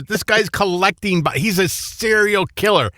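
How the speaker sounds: high-pitched incredulous exclaiming; mock outrage. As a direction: recount being aghast at the news. Acts out own outrage at story.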